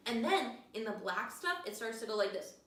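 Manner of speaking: ordinary delivery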